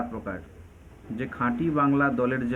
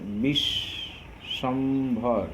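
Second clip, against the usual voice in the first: very slow Bishwambhar, as বিশ... শম... ভর; syllable split; much slower than usual. Shown as a demonstration. Teacher counts closed syllables in Bishwambhar, syllable split. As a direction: draw out Bishwambhar, pausing between syllables. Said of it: "এখানে কয়টা রুদ্ধ দল আছে".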